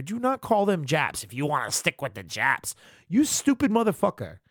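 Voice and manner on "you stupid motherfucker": high, contemptuous jab; angry insult. Real, not acted. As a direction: spit the insult at him. Insulting the actor.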